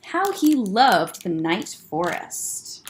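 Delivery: ordinary delivery, nothing marked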